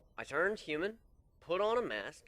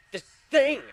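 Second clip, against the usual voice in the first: anguished outburst; 14 semitones higher, 13 dB louder than usual. Acted.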